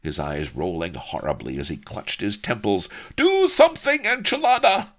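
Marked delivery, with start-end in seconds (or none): 3.2-4.9 high, loud tyrant's shriek; screaming. A narrator's reading. Don Lardo appeals to his henchman, screaming. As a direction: scream desperately for help. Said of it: "screamed Don Lardo".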